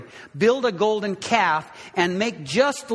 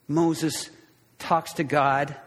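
ordinary talk

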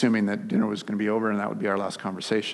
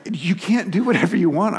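higher and louder, losing patience; raised voice; 9 semitones higher, 6 dB louder than usual. Retold or imitated. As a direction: snap back at the child. Father loses calm in the fit.

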